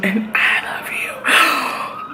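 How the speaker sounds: slow, unvoiced loving whisper. Real, not acted. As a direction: whisper it tenderly to the viewer. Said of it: "like I say at the end of all my videos".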